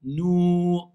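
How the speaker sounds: Nous as a long, pure "nouu"; sustained "nouu" on Nous; correct vowel. Shown as a demonstration. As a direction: hold Nous on a tight, steady OU. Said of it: "C'est pas ah".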